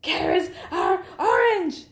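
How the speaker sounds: high-pitched, strained yell; exasperated shout. A narrator's reading. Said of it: "shouted Bird".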